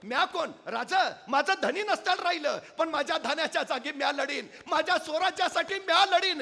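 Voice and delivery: high, loud vow to fight; young widow vowing to fight for swaraj; defiant widow. Acted. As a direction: step forward and swear to fight. सरकन पुढं झाली.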